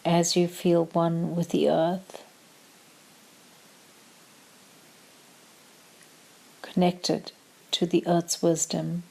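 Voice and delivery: calm meditation voice with long pauses; short, measured phrases, leaving room to breathe; guided meditation. Acted. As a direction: guide them gently into stillness, unhurried.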